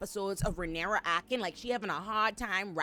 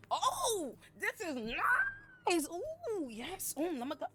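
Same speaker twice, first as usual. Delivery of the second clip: high, drawn-out pampered cooing; mock luxuriating Ulf; 8 semitones higher, 6 dB quieter, slower than usual. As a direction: savor every word like a spoiled guest, pitched way up. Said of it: "Ulf is in there like".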